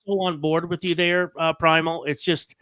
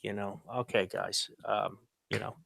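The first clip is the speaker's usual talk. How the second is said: much softer, said under the breath to oneself; inner thought; 10 semitones lower, 17 dB quieter than usual. Retold or imitated. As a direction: mutter it privately, barely audible. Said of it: "I thought to myself".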